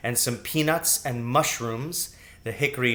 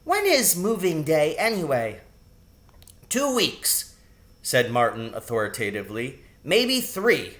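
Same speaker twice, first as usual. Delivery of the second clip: put-on a touch higher kid voices; lightly voiced mouse children; 4 semitones higher than usual. A narrator's reading. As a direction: voice the young mice lightly. Sisters and Martin voiced.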